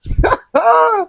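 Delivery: a sharp, high mocking laugh; high gloating laugh. Real, not acted. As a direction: laugh at his downfall. Gloats over Jonathan's doom.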